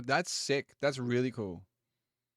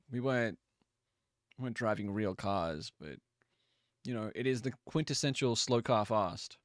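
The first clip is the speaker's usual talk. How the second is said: usual speaking voice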